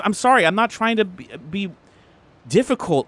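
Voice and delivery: earnest, halting excuse; defensive apology. Acted. Boyfriend apologises in the quarrel.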